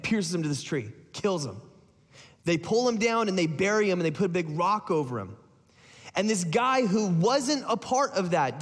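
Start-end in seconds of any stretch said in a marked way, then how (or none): none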